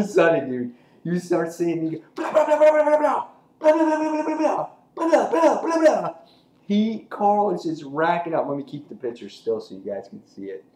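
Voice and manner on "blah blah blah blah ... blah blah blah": high, loud mock gunfire chatter; machine gun noise. Imitating machine gun fire.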